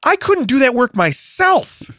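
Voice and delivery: high, drawn-out incredulous protest; indignant disbelief. Retold or imitated. Acts out own indignant disbelief.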